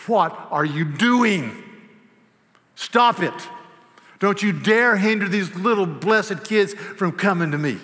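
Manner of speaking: loud, high rebuke, slow on the question; irate Jesus. Acted. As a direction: scold the disciples for hindering the children. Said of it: "was irate with them".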